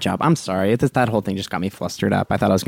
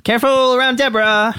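much higher, louder sing-song; high mocking echo; 14 semitones higher, 3 dB louder than usual. Deborah parrots the captain in a high mocking voice. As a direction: echo it back with a sneer.

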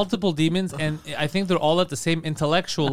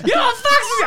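much higher, louder, laughing through it; laughing disbelief; 6 dB louder, faster than usual. Retold or imitated. Asking Adam about his fax machine, laughing in disbelief. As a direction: crack up mid-question.

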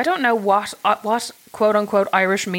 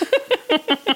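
laugh; 12 semitones higher than usual. Real, not acted. Laughs at her own joke.

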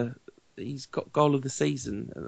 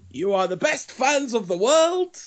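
high-pitched, grand impression of a manager's speech; badly attempted accent, proclaiming to the crowd; hammed-up foreign manager addressing the fans; Louis van Gaal; 10 semitones higher than usual. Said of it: "I can't do the accent".

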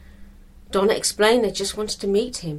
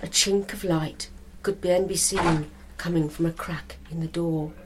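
ordinary delivery, nothing marked

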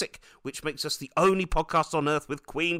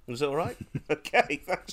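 ordinary delivery

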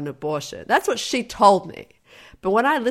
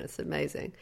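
ordinary talk

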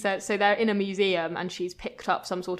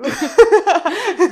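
loud laugh; 12 semitones higher, 16 dB louder than usual. Real, not acted. Laughing after reading quote.

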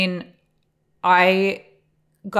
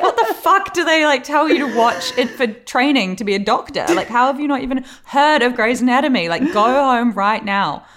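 louder and higher, laughing at first, slowing at the end; exasperated; 6 semitones higher, 6 dB louder than usual. Retold or imitated. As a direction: rant at her in disbelief. Scolding sister in retold scene.

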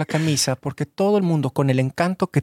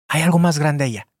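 usual speaking voice

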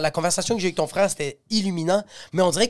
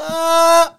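cri aigu imité; 11 semitones higher, 8 dB louder than usual. Retold or imitated. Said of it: "elle fait".

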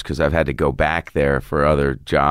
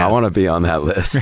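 laughing. Real, not acted. Joking about end-times podcast.

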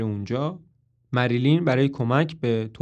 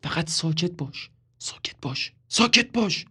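desperate hushing under the breath; urgent whisper; 4 semitones higher than usual. A narrator's reading. Toby desperately hushing Marilyn in a whisper. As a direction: shush her, pleading and voiceless.

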